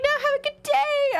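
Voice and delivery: very high mock-polite send-off; polite stranger. Dog owner leaving after being barked at, polite.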